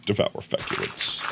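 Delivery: low, whispered deadpan movie line; flat ominous trailer growl. Retold or imitated. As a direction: intone it like a horror trailer. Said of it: "in that voice".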